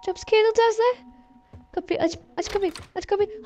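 gergin ve korkmuş. Real, not acted. Korkup kendini yönlendiriyor.

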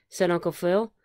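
ordinary delivery, nothing marked

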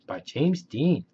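plain reading voice

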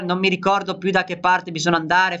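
ordinary talk, nothing marked

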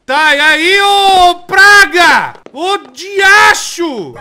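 slow, loud, high drawn-out shout; exasperated yell. Real, not acted. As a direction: drag it out, fed up with the game. Gamer yelling at the game.

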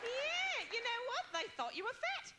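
very high, sing-song Australian agent; Australian uptalk. Acted. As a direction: deliver it like good news in exaggerated Australian upspeak. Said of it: "they go up at the end of a sentence".

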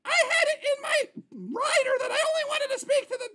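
very high, indignant diva complaint. Acted. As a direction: demand your agent in a high put-on star voice.

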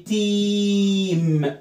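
"team" very slow, as "teeeem"; "team" with a long sustained vowel; long E demo. Shown as a demonstration. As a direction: hold the ee in "team". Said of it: "اعملها لونج إي".

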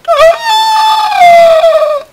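loud high yelp; startled shout. Real, not acted. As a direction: jump at a sudden scare. Startled shout while gaming.

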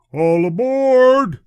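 long, high, loud all aboard; train conductor calling. A narrator's reading. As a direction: stretch it out across the platform. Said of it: "the conductor shouted".

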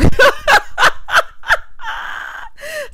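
high cackling laughter, loud and breathless; real cackle at her own joke; shrieking laugh. Real, not acted. Recapping reality TV, she cracks up in a high cackle.

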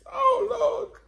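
squeaky panicked whimper; high-pitched panic. Real, not acted. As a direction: voice jumping way up in alarm. Spicy noodle challenge panic.

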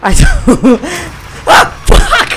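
high, loud laughing shrieks; hysterical panic. Real, not acted. Player cornered by the monster, hysterical laughing scream. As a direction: shriek and laugh in helpless terror.

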